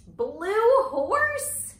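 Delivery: blue horse sprung out high and loud; excited reveal. Acted. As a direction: make the silly color a big surprise. Silly color revealed in book.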